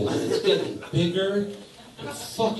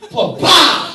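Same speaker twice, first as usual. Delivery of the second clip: higher, louder pa-pa; mock proud cry; 10 semitones higher, 9 dB louder than usual. Comic voicing the woman showing off, loud mock pa-pa. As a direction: announce it like a proud reveal. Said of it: "she goes".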